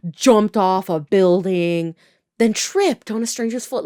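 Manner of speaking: normal talking voice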